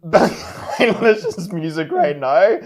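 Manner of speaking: usual speaking voice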